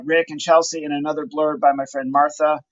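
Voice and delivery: ordinary talk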